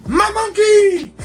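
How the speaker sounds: high, slow, loud cry of joy; excited shout. Retold or imitated. Fan spotting the figure in the store, ecstatic high squeal. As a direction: stretch it out like a kid finding a toy. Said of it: "I got so excited".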